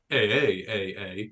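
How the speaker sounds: put-on Canadian accent, eh, eh; mock Canadian eh repeated. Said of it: "So you became Canadian".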